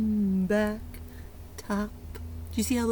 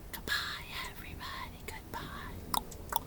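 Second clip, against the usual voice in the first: much softer whispered singing; hushed goodbye song; quiet whisper; 16 dB quieter than usual. Shown as a demonstration. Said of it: "goodbye song".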